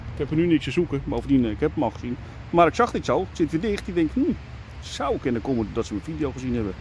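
usual speaking voice

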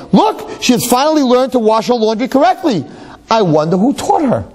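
high, eager news to husband; excited wife. Acted. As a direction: tell him the good news eagerly. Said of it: "she gets all excited".

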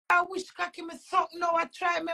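ordinary talk

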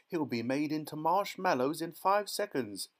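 high, indignant protest; snapping. A narrator's reading. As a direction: snap it as a worried mother. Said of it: "snapped Missus Gloop".

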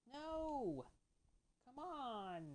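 loud, high cry of frustration; dismayed outcry. Real, not acted. Player losing a life in the game, loud frustrated cry. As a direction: wail it out as the game beats you.

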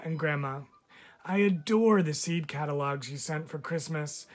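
usual speaking voice

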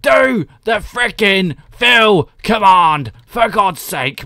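loud, high, slow mock rage; imitated raging. Streamer voicing a viewer raging at him, mock angry shout. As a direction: bellow it out, imitating an exasperated viewer. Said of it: "R six raging at me".

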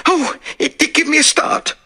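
high, quavering, near tears; shaken maid. Acted. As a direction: still trembling from the shock.

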